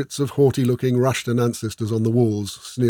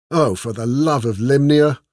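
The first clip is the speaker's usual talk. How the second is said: louder, groaned exclamation; exasperated groan; 3 semitones higher, 5 dB louder than usual. A narrator's reading. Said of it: "Esmeralda groaned".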